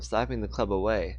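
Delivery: ordinary delivery, nothing marked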